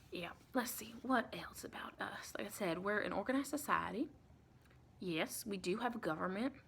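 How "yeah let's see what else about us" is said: thoughtful whisper. Acted. Vampire recruiter wonders what to explain next, whispering. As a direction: muse quietly, whispered. Musing aloud to client.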